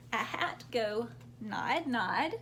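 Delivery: ordinary delivery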